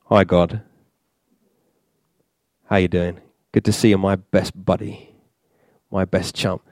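low, soft, offhand mate's hello; overfamiliar greeting. Acted. Mock worshipper strolling in to God, overfamiliar greeting. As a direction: greet God casually like an old chum. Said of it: "kinda like walking into the presence of God".

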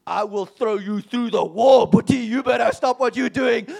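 loud slow menacing threat; elder threatening the angry man, slow and loud; giant's warning. Retold or imitated. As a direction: lean in big and slow like a giant. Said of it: "Mac gets up and he's this giant".

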